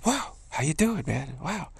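high, delighted wow; retold startled hello to a friend; surprised greeting. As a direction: greet him, caught off guard. Friend suddenly appears, greeting.

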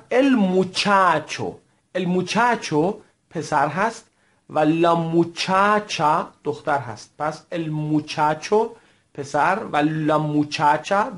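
muchacho and muchacha said slowly, ch crisp; teacher models muchacho and muchacha slowly; slow model words. Shown as a demonstration. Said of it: "تکرار بفرمایید".

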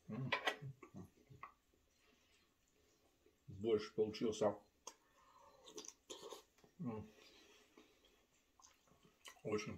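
chewing while talking; talking between bites; mouth full. Real, not acted. Seen 1: bites bread before, chews, then spoons borscht toward mouth.

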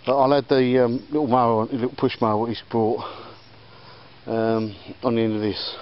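ordinary talk, nothing marked

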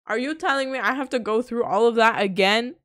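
outraged streamer yelling at the screen; exasperated shout. Real, not acted. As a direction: yell at the game in disbelief. Game made him replay scene.

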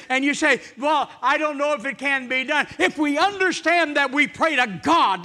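high, loud, driving pulpit delivery; preaching at full force. Acted. Preacher proclaiming blessings for the coming year, at full force. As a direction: preach it to the back row.

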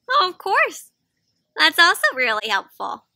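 bright chipper bunny agreeing; eager little helper voice; cheery bunny. Acted.

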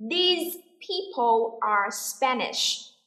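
very slow, word-by-word sentence; drawn-out, careful teacher's delivery; repeat-after-me model. Shown as a demonstration. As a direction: model it slowly for learners to copy. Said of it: "listen and repeat after me".

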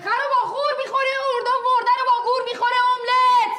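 high, loud chanted nonsense rhyme; shouted rhyme. Acted. Assistant shouting a silly rhyme into the argument. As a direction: bellow the rhyme to join the row.

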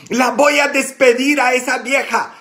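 high, loud angry outburst; furious. Retold or imitated. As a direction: spit it out like an enraged priest. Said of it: "me dijo todo furioso".

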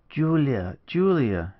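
earnest, entreating appeal; imploring. A narrator's reading. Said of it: "she cried imploringly".

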